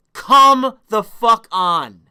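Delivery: slow, loud, exasperated drag; fed up with the chat teasing him, slow and loud. Real, not acted.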